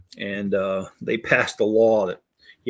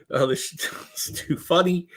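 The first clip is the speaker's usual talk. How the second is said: ordinary delivery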